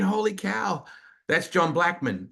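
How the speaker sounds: high-pitched, starstruck reaction; retold amazement. Host reliving hearing the famous voice-over man, amazed. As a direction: let the wonder lift the voice. Retelling awestruck thought on stage.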